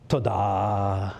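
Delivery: "Todah" said low and slow, stress on the second syllable, TO-DAH; Hebrew word. Shown as a demonstration. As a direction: say "Todah" carefully like a Hebrew lesson. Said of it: "The Hebrew word for thanksgiving is this".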